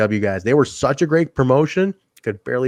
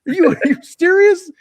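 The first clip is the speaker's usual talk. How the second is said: very high, loud outraged disbelief; imitating an exasperated Tenay; 7 dB louder than usual. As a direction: squeal it out in mock outrage. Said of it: "He's like".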